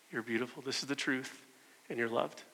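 soft, gentle affirmation; tender reassurance. Acted. Looking her in the eye to remind her, tender and soft. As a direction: say it softly, as comfort.

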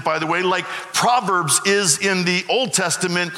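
ordinary talk, nothing marked

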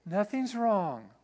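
slow, drawn-out denial; mock innocence, dragged out; evasive wife. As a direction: stretch it out, feigning that all is fine. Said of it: "why are you talking like this".

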